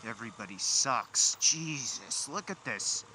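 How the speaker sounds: loud, high frustrated outburst; frustrated yelling at traffic; exasperated. Real, not acted.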